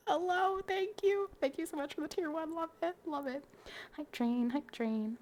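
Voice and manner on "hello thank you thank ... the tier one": high, loud squeal of thanks; light up and squeal hello; excited thanks. Real, not acted. Streamer thrilled at a tier one sub, excited.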